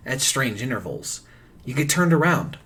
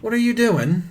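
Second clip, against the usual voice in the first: put-on higher pirate accent, a touch hammy; 7 semitones higher than usual. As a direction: challenge a stranger like a pirate. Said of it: "Sorry, that's a pirate voice".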